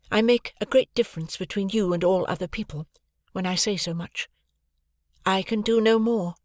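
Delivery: calm and unmoved; Estella's voice. A narrator's reading. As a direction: Estella insisting on her coldness. Estella's higher voice.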